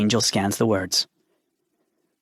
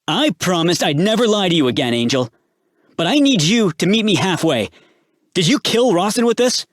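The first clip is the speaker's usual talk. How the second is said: desperate pleading; 8 semitones higher, 4 dB louder than usual. A narrator's reading. Desperate confrontation, knife to cheek.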